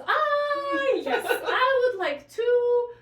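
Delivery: put-on high, loud, slow elderly-man voice; aphasia patient. Nurse retells patient ordering breakfast, high slow mock old man. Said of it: "And he goes".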